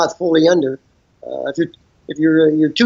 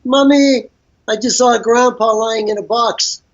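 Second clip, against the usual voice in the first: put-on child voice; 6 semitones higher than usual. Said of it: "runs to his mother and says".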